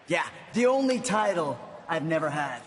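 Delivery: ordinary talk, nothing marked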